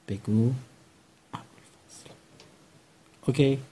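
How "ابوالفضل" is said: breathy whispered name as a hint; whispered prompt. Shown as a demonstration. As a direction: whisper the answer to the child. Teacher whispers the word to prompt. (نجوای آرام اسم برای راهنمایی)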